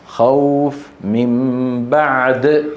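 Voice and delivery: very slow tarteel of the verse; slow recitation. Shown as a demonstration. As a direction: chant it stretched out. Teacher recites example with pause. (تلاوة بطيئة)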